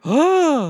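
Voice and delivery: high, airy cartoon gasp; Peach. Co-host imitates the game's reaction noises, high mock gasp. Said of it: "Same with Peach going like".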